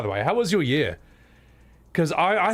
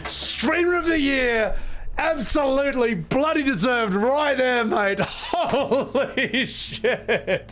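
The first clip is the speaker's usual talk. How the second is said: high, elated, drawn-out hype; astonished celebration; 7 semitones higher, slower than usual. Real, not acted. Streamer cheering Charlie as Streamer of the Year, astonished. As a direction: crow in amazed disbelief, stretching key words.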